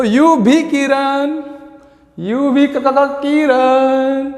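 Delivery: slow, stammering you भी का- का- किरण; a lightly hammed Shah Rukh Khan line; Shah Rukh Khan stutter. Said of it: "Shahrukh Khan ... कैसे बोलता है". (शाहरुख खान के अंदाज़ में हकलाते हुए)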